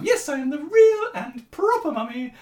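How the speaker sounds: hammed-up high mummy reply; silly put-on lady voice. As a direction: brightly affirm in a fake mum voice. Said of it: "affected a stupid female accent".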